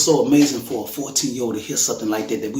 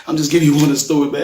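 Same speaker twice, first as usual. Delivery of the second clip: laughs through the words, louder and quicker; laughing; 7 semitones higher, 6 dB louder, faster than usual. Real, not acted. Father laughing at the memory while telling listeners. Laughing at his own story.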